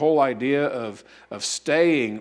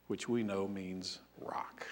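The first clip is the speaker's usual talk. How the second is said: soft unvoiced whisper, almost secret; whispered aside; 7 semitones lower, 17 dB quieter, slower than usual. Real, not acted. Preacher whispers an aside to the congregation, hushed. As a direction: breathe it out like a shared secret.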